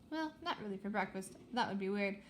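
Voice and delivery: usual speaking voice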